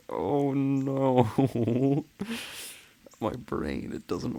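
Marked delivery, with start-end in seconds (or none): none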